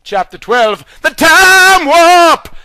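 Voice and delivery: loud, high, slow dramatic title; announcing. Acted. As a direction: boom out the chapter title. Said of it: "chapter title announced".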